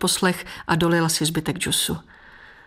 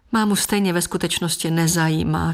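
ordinary delivery

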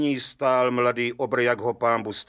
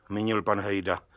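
ordinary delivery

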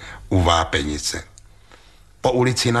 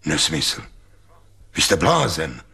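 lower, grumbling dismissal; low rumbling retort; 5 semitones lower than usual. A narrator's reading. Doctor Link grumbles low at Heribert, dismissing him. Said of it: "hučel pan doktor Link".

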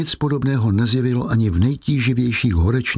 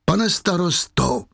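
higher, drawn-out cry of appeal; 6 semitones higher, slower than usual. A narrator's reading. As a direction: cry out slowly at a raised pitch. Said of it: "vykřikla Fantina".

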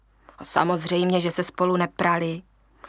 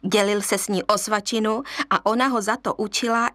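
plain reading voice

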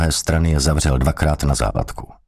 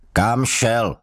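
higher, sharp sergeant's bark; barked question; 6 semitones higher than usual. A narrator's reading. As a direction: snap the question out. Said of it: "štěkl na něho strážmistr". (strážmistr štěkne otázku)

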